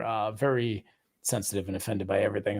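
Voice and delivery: ordinary talk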